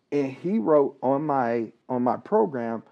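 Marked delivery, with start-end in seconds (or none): none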